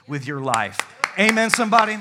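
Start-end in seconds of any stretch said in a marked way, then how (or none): 1.2-2.0 loud, high call for an amen. Real, not acted. Preacher shouting to the church for an amen. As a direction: shout it out to the congregation.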